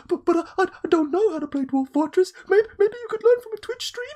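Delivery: very high, loud, stammering plea; panicked squeak. Retold or imitated. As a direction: squeal like a terrified hostage. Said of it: "And then you go".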